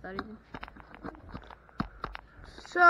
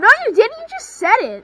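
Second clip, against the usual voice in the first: loud protest; 5 semitones higher, 10 dB louder, faster than usual. Real, not acted. Disputing the win.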